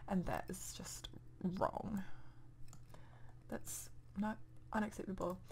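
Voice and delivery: hushed, disapproving whisper; a hushed half-whisper of disapproval; scandalised whisper. Real, not acted. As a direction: whisper it like it's shameful. Public bathroom disapproval aside.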